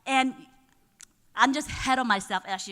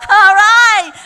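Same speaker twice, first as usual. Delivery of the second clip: laughing; 8 semitones higher, 16 dB louder than usual. Real, not acted. Laughing at own auctioneer bit.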